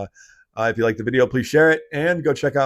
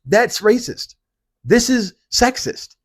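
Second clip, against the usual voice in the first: indignant; 10 semitones higher, 5 dB louder than usual. Real, not acted. Outraged exclamation.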